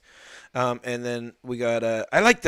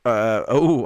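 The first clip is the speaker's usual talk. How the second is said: breathless, catching air; winded, gasping between words; out of breath; 8 dB louder, much slower than usual. Real, not acted. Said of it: "I'm just out of breath here".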